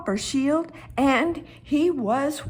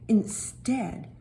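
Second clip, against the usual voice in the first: plain reading voice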